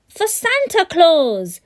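high, loud, slow snarl; spoiled boy plotting; snarling brat. Acted. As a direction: spit out the name with menace. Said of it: "snarled Bradley".

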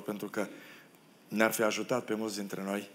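usual speaking voice

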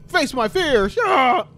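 loud high shout breaking into a yell; battle cry into scream. Real, not acted. Gamer charging into danger, shout then scream.

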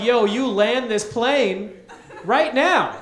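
1 demanding. Pretend. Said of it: "be like".

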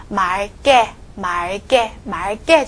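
말 게 said as 말께, l kept and tense kk; left-side consonant. Shown as a demonstration. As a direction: hold the l in 말, then a tense 게 in 말 게. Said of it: "要读左边的发音". (말 게读左边ㄹ，게读成께)

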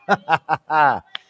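short run of chuckles; laugh. Real, not acted. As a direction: laugh after the shouting. Preacher laughs after praising.

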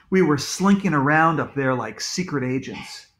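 ordinary delivery

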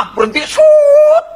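very high, long screech on the last word; screeching halt. Acted. Storyteller acting out horse braking, shrill screech. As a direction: imitate skidding to a stop, stretched and loud. Horse halts abruptly at cliff edge.

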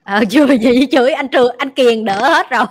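a loud, fast laughing retort; loud teasing. Real, not acted. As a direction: tease them loudly, half laughing. Teasing laugh about never being scolded.

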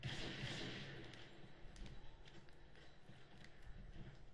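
breathy whispered repeat; hushed echo of the line. Real, not acted. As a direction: whisper it under your breath. Repeats the same line twice.

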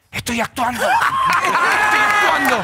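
hammed-up actor protesting; mock stage whisper turned shout. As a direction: hiss back that he is acting. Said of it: "Y Leo del piso le dice".

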